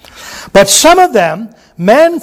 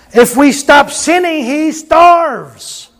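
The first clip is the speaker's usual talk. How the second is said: preaching shout; 6 semitones higher, much slower than usual. Real, not acted. Preacher rising to a climax.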